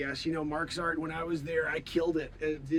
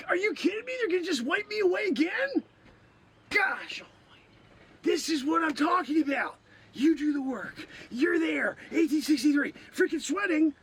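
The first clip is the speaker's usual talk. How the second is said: indignant rant; 7 semitones higher, 4 dB louder than usual. Acted. Outraged rant continues.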